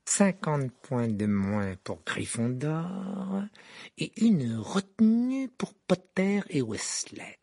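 velvety menace. A narrator's reading. Rogue punishes Potter and Weasley, velvety menace. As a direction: draw out each punishment smoothly, pitch rising. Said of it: "dit-il de sa voix la plus veloutée".

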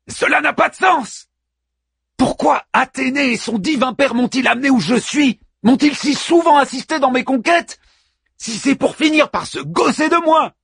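very high outraged protest ending in a shout; furious hero. Acted. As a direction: rage that the gods mock you. Achilles rages at the gods.

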